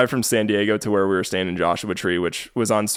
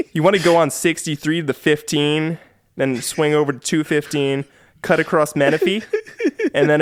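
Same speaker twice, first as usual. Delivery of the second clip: higher-pitched Californian sketch voice; Californians sketch accent; 6 semitones higher than usual. Acted. Podcaster giving freeway directions on request, in a Californians sketch accent. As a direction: put on a drawn-up Californian accent, like sketch comedy. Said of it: "do it in the Californian's voice".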